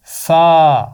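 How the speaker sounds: فا named loud and clear; letter name fa. Shown as a demonstration. Teacher naming the letter for the class, loud. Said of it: "اس کا نام ہے".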